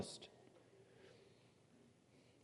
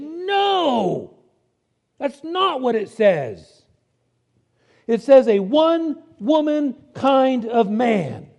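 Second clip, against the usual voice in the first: angry professor; 5 semitones higher, 4 dB louder, much slower than usual. Retold or imitated. Angry professor corrects students.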